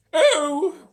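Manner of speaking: a long dismayed wail. A narrator's reading. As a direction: grandmother wailing in despair. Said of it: "wailed Grandma Josephine".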